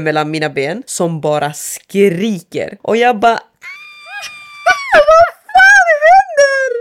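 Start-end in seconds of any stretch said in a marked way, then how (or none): 3.3-6.7 extremely high, slow, wailing shriek; loud, tearful-sounding retold panic; terrified scream. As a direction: shriek in horror, drawing out the words. Acted scream at crying head.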